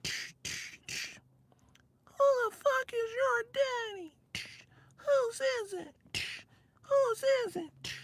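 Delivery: slow, high falsetto demand; squeaky media voice. Pretend. Imitating a media guy questioning his girl, squeaky falsetto. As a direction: squeak it out slowly as mock dirty talk. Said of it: "sound like that and shit".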